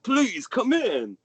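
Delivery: high, merry fatherly gush; jolly father. Acted. The merry father welcoming his son home, high and gushing. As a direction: beam over the boy like a proud dad.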